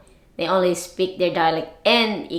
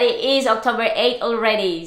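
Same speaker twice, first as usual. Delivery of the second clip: ordinary delivery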